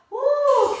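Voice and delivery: high, loud cheering whoop; excited whoop. Real, not acted. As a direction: let out a big happy whoop. Instructor cheering mid workout.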